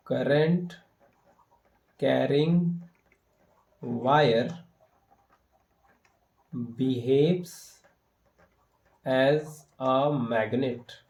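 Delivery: word by word with long pauses; slow dictation. Real, not acted. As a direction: dictate it slowly for note-taking. Dictating the written answer word by word. (रुक-रुक कर, धीरे-धीरे लिखवाते हुए)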